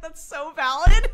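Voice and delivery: laughing loud and high. Real, not acted. Agreeing with the brother, laughing loudly.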